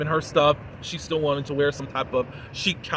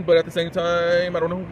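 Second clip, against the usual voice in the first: ordinary talk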